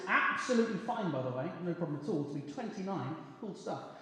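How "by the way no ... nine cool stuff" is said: hurried aside. Acted. Hurried self-reassuring aside.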